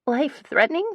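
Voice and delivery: alarmed squeak. A narrator's reading. Phoebe reacting to the risky plan, alarmed squeak. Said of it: "I squeak, alarmed".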